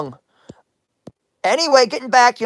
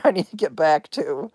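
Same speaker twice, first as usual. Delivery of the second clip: ordinary talk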